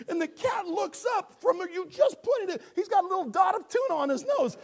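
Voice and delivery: high, shouted comic telling; loud, high-pitched incredulous rant; worked-up storytelling. Real, not acted. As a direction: shout the tale in mock exasperation. Shouted comic climax of cat story.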